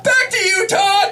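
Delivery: very high, shouted sign-off. Retold or imitated. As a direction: yell it to the booth. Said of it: "And she'd be like".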